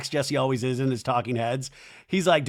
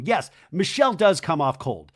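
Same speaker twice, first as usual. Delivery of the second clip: usual speaking voice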